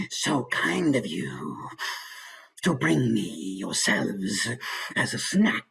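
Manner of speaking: slow, menacing dragon with heavy breathing; slow breathy dragon purr with evil chuckles. Shown as a demonstration. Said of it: "maybe there's a lot of breathing in there".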